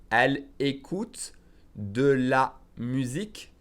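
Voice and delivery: very slow French, word group by word group; slow demonstration. Shown as a demonstration. French teacher repeats the phrase for learners, slowly. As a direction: spell it out by ear, pausing before "de la musique". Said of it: "Again, slowly".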